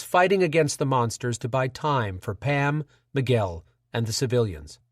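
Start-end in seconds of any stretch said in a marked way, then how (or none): none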